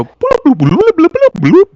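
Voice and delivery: very high, loud burbling bloops; Modron bloops. Acted. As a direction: burble like a little mechanical creature. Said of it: "the Modron looks at the guys and go".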